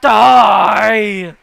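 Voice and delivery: huge drawn-out bellow; long, full-force menacing shout; ghostly shout. Acted. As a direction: roar it as a deadly command. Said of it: "You hear from".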